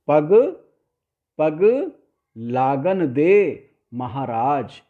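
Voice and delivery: पग alone, stop, then a slow लागन दे, महाराज; gaps between words. Shown as a demonstration. Teacher reciting a song line clearly, gaps between words. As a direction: isolate पग and stretch लागन दे. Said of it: "थोड़े-थोड़े gap देकर".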